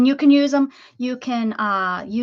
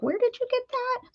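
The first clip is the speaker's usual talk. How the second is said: higher-pitched imitation of curious viewers; viewers asking; 14 semitones higher than usual. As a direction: mimic the audience's question, pitched up. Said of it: "you guys are like".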